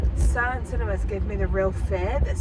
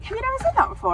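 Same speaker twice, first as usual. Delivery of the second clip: mock disbelief; 3 dB louder, faster than usual. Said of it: "And he was like".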